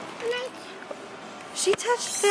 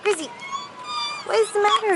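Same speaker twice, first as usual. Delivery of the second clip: usual speaking voice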